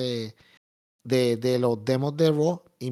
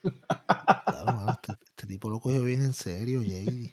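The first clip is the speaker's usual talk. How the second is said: laughing; 3 semitones lower, 3 dB quieter than usual. Real, not acted. Laughing at co-host's sign-off.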